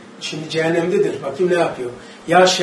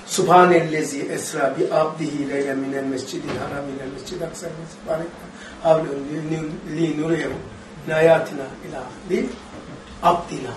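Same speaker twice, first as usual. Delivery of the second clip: Quran recitation; 3 semitones lower, 6 dB quieter than usual. Acted. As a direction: intone the verse as in prayer. Quran verse recited in chant.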